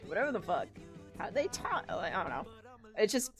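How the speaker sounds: normal talking voice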